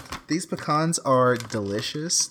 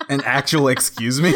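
indignant; 4 semitones higher, 6 dB louder than usual. Acted. Mock offense, louder take.

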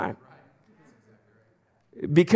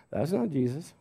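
mock disapproving verdict; 9 dB quieter than usual. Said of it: "we would say".

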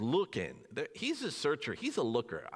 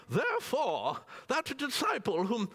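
lightly posh English reading, a bit higher; Downton Abbey voice; 8 semitones higher than usual. Pretend. Pastor reading King James in a mock English accent. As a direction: read scripture like an English aristocrat. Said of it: "my Downton Abbey voice".

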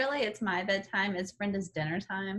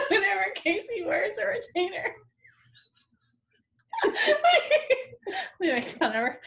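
high, giggling through words; can barely talk for laughing; laughing hard; 12 semitones higher, 5 dB louder than usual. Real, not acted.

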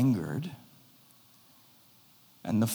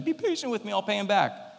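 high, pleading plea; begging fellow servant; 8 semitones higher, faster than usual. Acted. Fellow servant on his knees begs for patience, high pleading. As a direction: beg for time, pitched up high. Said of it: "begged him".

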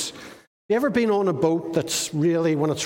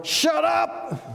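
commanding shout; 9 semitones higher than usual. Acted. Jesus orders the wind and waves, shouting. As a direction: shout the storm down. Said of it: "The actual translation is quiet or".

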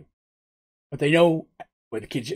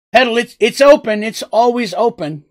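called out; 7 semitones higher, 5 dB louder than usual. Acted. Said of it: "They're like".

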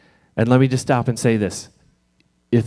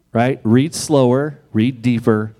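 ordinary delivery

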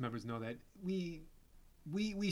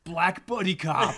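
movie trailer voice; 4 semitones higher, 8 dB louder, slower than usual. Pretend. Mock tagline announcement.

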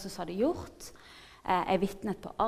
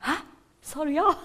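high, loud disbelief tipping into laughter; comic re-enacted surprise; astonished reaction; 6 semitones higher, 7 dB louder than usual. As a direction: blurt it out, stunned and amused. Acts her astonishment at his yes.

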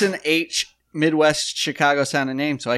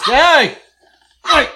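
shout; 12 semitones higher, 9 dB louder than usual. Real, not acted. Shouting at the dog.

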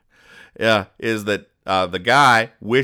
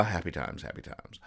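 fading whisper; 4 semitones lower, 14 dB quieter than usual. Real, not acted. Closing aside, fading to whisper.

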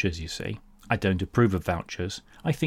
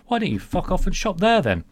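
exasperated outburst; 10 semitones higher, 3 dB louder than usual. Retold or imitated. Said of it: "I thought to myself".

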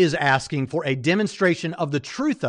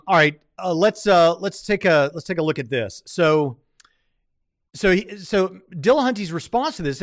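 ordinary talk, nothing marked

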